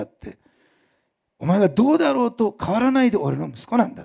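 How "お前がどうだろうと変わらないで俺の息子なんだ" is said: higher and louder, fervent declaration; impassioned fatherly insistence; passionate father. Retold or imitated. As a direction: proclaim it like a father who won't let go.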